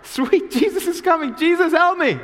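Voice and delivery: high, loud, laughing plea; pushy beggar. Acted. Blind beggar shamelessly hollers at passing Jesus, high and loud. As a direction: beg shamelessly, half laughing. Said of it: "he's upping the awkwardness".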